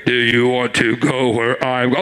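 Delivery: booming voice of God. Retold or imitated. Preacher to congregation, God's voice as he did not hear it, a deep boom. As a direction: thunder it out like God from the sky. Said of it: "And I didn't hear".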